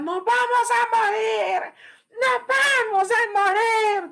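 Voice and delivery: high, slow panicked shriek; mock a terrified passenger wailing; preacher imitates panicked lady on plane, high desperate scream; screaming woman. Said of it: "comenzó a gritar desesperadamente".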